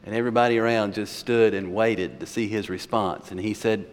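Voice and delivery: ordinary talk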